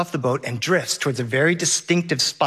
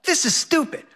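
high-pitched mock whine of a let-down kid; imitating a child's complaint, pitch climbing; Henry whining; 7 semitones higher than usual. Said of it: "Henry whines".

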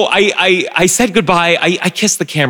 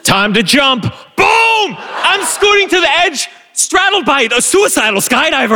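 adrenaline rush; 11 semitones higher than usual. Real, not acted. Jumping out of the plane.

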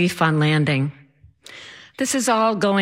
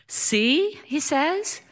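high sing-song husband gloat; mock triumphant, pitched-up teasing; smug told-you-so; 8 semitones higher, much slower than usual. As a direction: lilt it upward, pleased to be right. Husband gloats after the strike.

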